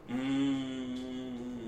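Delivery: ordinary talk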